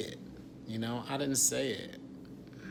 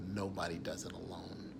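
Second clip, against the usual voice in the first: whispered, much softer; secret whisper; 5 semitones lower, 10 dB quieter than usual. Real, not acted. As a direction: let them in on a secret, hushed. Revealing Hollywood secret.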